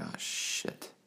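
breathless whispered oh shit; hushed dread at the realization; shocked whisper. Retold or imitated. Caught out, a shocked whisper.

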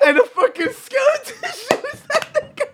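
high, slow laughing near tears; laughing hard. Real, not acted. Teller laughs hard as the skeleton kills the horse. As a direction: laugh till it almost sounds like crying.

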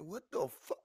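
high, loud disbelief; hammed-up startled yelp; shocked outburst. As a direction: blurt it out in alarm. Acted shock while driving.

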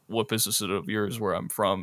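low and quiet, cold killer threat; a hushed lightly voiced hitman; Anton Chigurh. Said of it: "you see it in the coin toss scene where he's just like".

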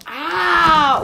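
sudden startled cry; erschrockener Aufschrei. Real, not acted. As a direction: jolt out a loud surprised ah. Startled while opening the box. (erschrockener lauter Ausruf)

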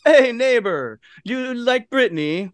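put-on a Russian accent that drifts toward Mario; hammed-up Russian accent, slow and high; bad Russian accent. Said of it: "That's kinda Mario, but I think it was Russian".

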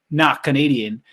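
ordinary talk, nothing marked

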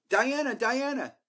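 calling out. Retold or imitated. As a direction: call after her across the steps. Calling out to a celebrity.

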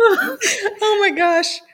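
slow, high laughing gasp. Real, not acted. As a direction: laugh it out slowly. Laughing over classmate's grandbaby.

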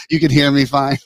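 laughing through the words; amused, laughing protest. Real, not acted. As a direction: tease back through real laughter. Laughing at co-host's joking dodge.

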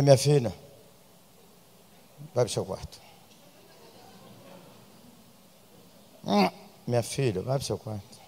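a soft, tender word to the daughter; calm the girl down kindly instead of scolding; gentle mother. Retold or imitated. Mother gently sending her caught daughter to her room, soft and tender. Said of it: "lembrei como é que o senhor me tratou".

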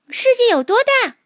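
put-on high, curious child voice; little mole voice. A narrator's reading. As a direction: ask like a small wide-eyed creature. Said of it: "它问老鼠".

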